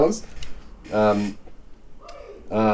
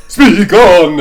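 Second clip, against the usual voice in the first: loud, higher vampire command; 5 semitones higher, 12 dB louder than usual. Acted. Reader trying a vampire voice, loud and higher. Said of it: "the vampire just popped in".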